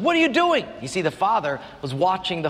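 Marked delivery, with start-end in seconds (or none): 0.0-0.7 indignant son. Retold or imitated. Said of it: "came up to him and said".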